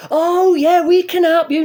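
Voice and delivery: high, loud cheery helpful voice; mock the helpful welcome he never got; mock friendly helper. To the unsmiling bouncers, a loud mock-cheery welcome. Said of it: "None of that".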